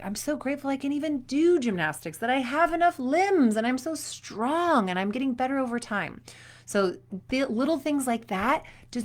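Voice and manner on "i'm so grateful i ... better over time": cheerful gratitude. Pretend.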